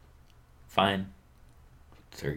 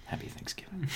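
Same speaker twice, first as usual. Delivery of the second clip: hushed dramatic farewell; breathy hero whisper; 11 semitones higher, 13 dB quieter than usual. Pretend.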